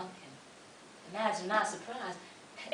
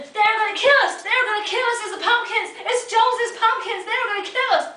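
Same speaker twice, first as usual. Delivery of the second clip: terrified yelling; 10 dB louder than usual. Acted. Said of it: "we were screaming".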